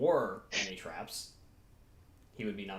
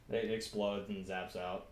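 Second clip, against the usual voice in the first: ordinary talk, nothing marked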